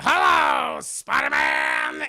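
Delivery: loud, high villain greeting; Green Goblin. Acted. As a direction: drag out the greeting with menacing relish. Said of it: "I'm gonna do the Dafoe".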